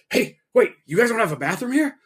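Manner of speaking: high-pitched imitation of a worried customer; retold urgent question, high voice; alarmed customer. Retold customer urgently needing bathroom.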